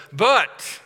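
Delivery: BUT hit loud and high; stressed but. Real, not acted. A preacher stressing the contrast word But, loud and high. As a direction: punch the word But as the turn. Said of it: "the word "but" is very significant".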